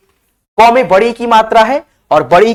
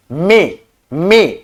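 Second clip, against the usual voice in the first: में said twice, slow and drawn out; slow demo pronunciation; 3 semitones lower, much slower than usual. Shown as a demonstration. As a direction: drag out में for the class to hear. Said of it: "ठीक है, एम".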